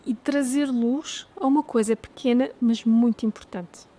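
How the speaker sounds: ordinary talk, nothing marked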